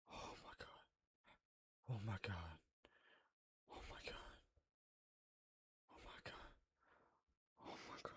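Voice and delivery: very soft, airy gasped words; barely audible, winded by fright; shaken whisper. Real, not acted. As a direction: whisper it over and over in shock. Recovering from the jump scare.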